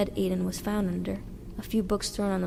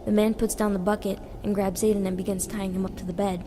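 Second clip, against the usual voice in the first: usual speaking voice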